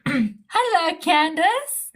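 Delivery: high, stretched-out hello; drawn-out greeting. Real, not acted. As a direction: call out a lilting hello, drawing it out. Breaks off to greet someone.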